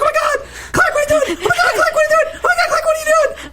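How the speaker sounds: very high, frantic squealing repetition; hammed-up panicked squeal; mock panic. Podcaster acting out Lois as Clark leaps off the roof, mock panic.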